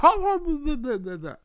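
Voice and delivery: big high burst of laughter; imitating laughing hard at the boss; retold hard laugh. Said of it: "I'm talking about laughing hard".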